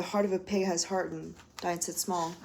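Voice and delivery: dice it small read evenly in recital voice; poem reading. Acted. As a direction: let dice it small land calmly. First take of refrain.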